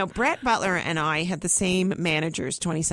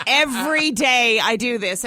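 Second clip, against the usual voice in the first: usual speaking voice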